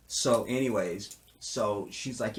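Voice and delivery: ordinary talk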